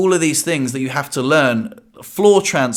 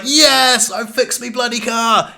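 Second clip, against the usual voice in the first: triumphant; 9 semitones higher, slower than usual. Retold or imitated. Man whose car heaters finally work, thrilled shout. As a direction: celebrate like you just won the cup. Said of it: "I was like".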